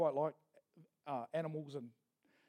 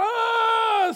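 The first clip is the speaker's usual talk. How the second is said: loud high squawk as it drops; high falling cry; 13 semitones higher, 11 dB louder than usual. Acted. Eaglet tumbling off the nest, crying out high. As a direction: let out a chick's falling shriek.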